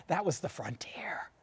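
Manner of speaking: unvoiced, breathy aside; whispered aside. Real, not acted. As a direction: tell it like a secret. Hushed aside on frontier.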